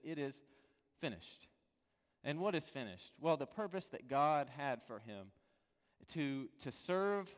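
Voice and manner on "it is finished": much softer, lower, falling to almost nothing; hushed last words. Acted. Jesus' final words on the cross, hushed and low. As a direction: let the words fade out like a last breath.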